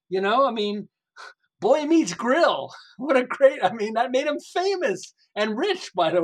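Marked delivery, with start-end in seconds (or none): 1.6-2.7 very slow, high, loud showcase; admiring slow name reveal; savoring the name. Real, not acted. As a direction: roll out each word like a marquee.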